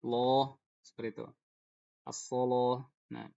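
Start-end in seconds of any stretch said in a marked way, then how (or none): 2.0-2.8 "As-soloh" slow, the lam thick; Warsh-style heavy lam. Shown as a demonstration. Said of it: "dibaca tebal lamnya".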